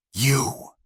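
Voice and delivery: a high, soft hissed word; villain hissing at the woman, mildly voiced. A narrator's reading. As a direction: spit the word out quietly. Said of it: "he hissed".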